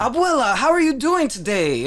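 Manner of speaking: high, pushed, overdone greeting to grandma; forced Spanish showiness. Acted. Host mocking forced film dialogue to grandma, exaggerated high greeting. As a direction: lay it on thick, pitched right up. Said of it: "I'm forcibly talking Spanish".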